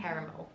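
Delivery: New Zealand vowels in "caramel"; E turned to I. Shown as a demonstration. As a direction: say "caramel" as "KA-ruh-mil". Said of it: "our E's become I's almost".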